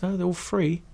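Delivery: excited colleague. Retold or imitated. Colleague telling him the games are free, excited. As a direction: brag like it's the best perk ever. New colleague boasting about perks.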